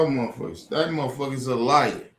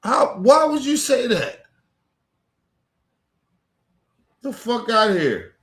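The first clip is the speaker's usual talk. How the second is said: higher, louder angry disbelief; outraged; 8 semitones higher, 3 dB louder than usual. Real, not acted. Vlogger ranting about the agents, loud outraged disbelief. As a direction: throw the question back in outrage.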